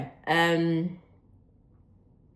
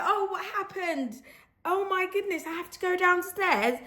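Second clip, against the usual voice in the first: high, soft, hammed-up British accent; best British accent; 11 semitones higher, 6 dB quieter than usual. Retelling herself asking French neighbours, best British accent. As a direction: ham up a high, softly spoken British accent. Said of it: "my best British accent".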